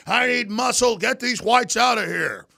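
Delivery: high, loud mock yell, slow on the last words; mock yelling professor. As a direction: ham up a bossy professor ordering people out.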